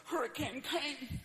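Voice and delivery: a sorry tale of loss; beaten-down homeowner. Acted. A family in the wreckage telling the camera their loss. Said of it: "they're always going like".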